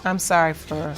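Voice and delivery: ordinary talk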